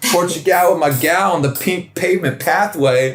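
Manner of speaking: lightly mocking beat poet recital; pretentious poet. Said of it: "look at this little beat poet".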